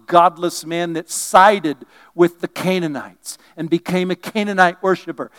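usual speaking voice

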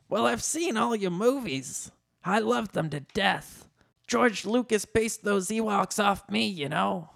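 high put-on fan voice; high, chatty comic character; Danny DeVito. A narrator's reading.

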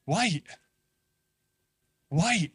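imitating Peter dawning on it; Peter realizing. Peter in the boat catching on, mildly excited. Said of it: "I think he's like".

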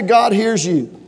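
normal talking voice

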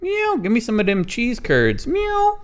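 high-pitched talking cat, begging; demanding little cat with a high voice; whiny cat voice. Acted. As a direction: beg between squeaky meows. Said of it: "Salem saying".

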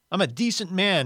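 aggrieved, defensive character voice; indignant insistence. Acted. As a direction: insist on your good name. Ghost insists on his decency.

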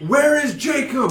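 high, loud, drawn-out mock yell; hammed-up outraged shout; freaking out. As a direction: act out a frantic demand, stretched high. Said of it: "And he's freaking out".

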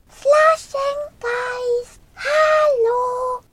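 long, high-pitched drawn-out calls for a lost friend; calling out. A narrator's reading. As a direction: call far and high for someone missing. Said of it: "so sehr sie auch riefen".

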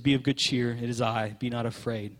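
preacher voicing Jesus calming the frightened men; gentle Jesus voice.

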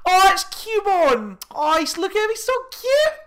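very high, loud squealing mimicry; shriek-coo with delight, stretched out; full-volume gushing. Imitating girl gushing over Cubone, full-volume squeal. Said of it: "Full, like".

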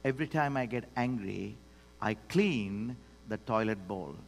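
low, soft, unhurried reply; quietly composed wife, deadpan delivery; very calm. Retold or imitated. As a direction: quote her calm answer softly. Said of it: "So she said very calmly".

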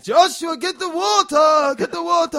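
high, loud frantic yelling; old man pleading with the brother in a loud high shout; desperate shout. Retold or imitated. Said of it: "And he went".

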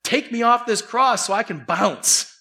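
a criminal's scornful dare; mocking taunt. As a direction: taunt him from the next cross. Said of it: "He's mocking him".

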